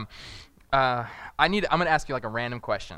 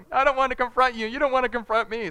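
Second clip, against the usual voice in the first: timid conflict-avoider; 8 semitones higher, 3 dB louder than usual. Retold or imitated. Couple avoiding conflict, mimed voice.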